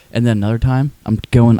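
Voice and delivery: normal talking voice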